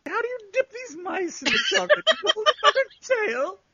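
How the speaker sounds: high, loud laughing joke; losing it completely while talking; hysterical laughter. Real, not acted. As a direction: shriek the joke through laughter. Joke told in laughing fit.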